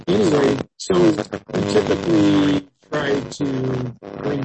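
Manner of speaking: muffled bad mic audio; talking underwater. Real, not acted. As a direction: voice garbled as if underwater. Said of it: "people are having a hard time understanding you".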